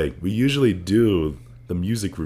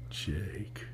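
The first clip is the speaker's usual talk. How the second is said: mock sexy; 15 dB quieter than usual. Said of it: "Does this sound sexy?".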